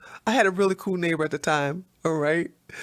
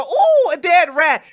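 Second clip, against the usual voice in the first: slow, high, louder ooh of wonder; mock delight; 6 semitones higher, 6 dB louder than usual. Imitating centrists pleased with a dead rat, mock delight. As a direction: savor it like a prize find. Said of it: "they'll go".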